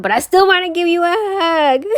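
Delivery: high, loud cutesy whine; gushing affection. Real, not acted. Longing to hug viewers, high gushing affection. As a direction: gush it high, drawing out the end.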